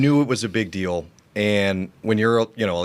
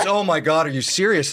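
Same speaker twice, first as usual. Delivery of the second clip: mock relieved surprise; 6 semitones higher than usual. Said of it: "he goes".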